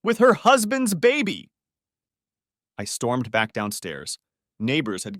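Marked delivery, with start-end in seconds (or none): 0.0-1.5 roar. Acted. Husband roaring at his pregnant wife, enraged. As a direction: bellow each word at her. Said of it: "I roared".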